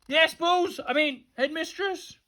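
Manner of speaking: high, flustered reply; startled high answer, softening; calling back. A narrator's reading. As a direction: call up, then correct yourself sheepishly. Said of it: "he called back from the playground".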